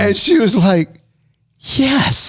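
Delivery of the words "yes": high, small yes of a teary lady; teary older woman. Retold or imitated. A retold woman accepting a hug, high and small. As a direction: give a tiny high grateful yes.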